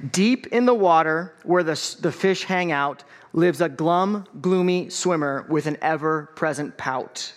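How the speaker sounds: put-on lively picture-book read-aloud for kids; story time reading. A narrator's reading. Pastor reading a picture book to the congregation, lively read-aloud.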